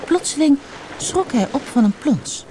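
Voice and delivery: plain reading voice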